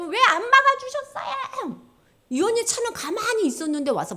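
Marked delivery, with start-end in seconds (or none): 0.0-1.8 high, drawn-out mock wail; 울며 따지기. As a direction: wail a reproach to God, slow and loud. Acted tearful reproach to God. (높고 길게 울부짖으며 하나님께 따지듯)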